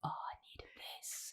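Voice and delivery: hushed, whispered aside; Jackie's whisper. Retold or imitated. Jackie secretly relishing the sympathy, whispered. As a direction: whisper it as a private confession. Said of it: "And Jackie's like".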